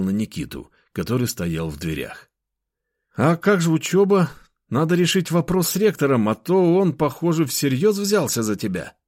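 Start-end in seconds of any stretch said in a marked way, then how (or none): 3.2-9.0 put-on higher, louder worried young man; young friend voice. Nikita voiced in dialogue.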